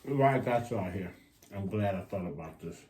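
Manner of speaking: ordinary delivery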